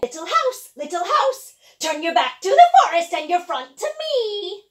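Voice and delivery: put-on loud commanding old witch. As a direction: call out like a witch. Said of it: "the old witch ... said".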